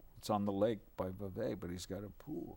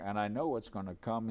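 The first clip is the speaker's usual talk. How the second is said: usual speaking voice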